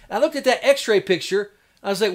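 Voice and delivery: ordinary delivery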